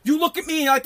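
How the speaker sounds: ordinary delivery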